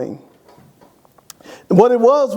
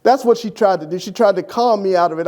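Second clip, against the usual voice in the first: usual speaking voice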